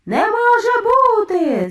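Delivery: loud, high, drawn-out cry; stretched shout of a witch crowd; shouting in disbelief. A narrator's reading. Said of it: "загукали відьми".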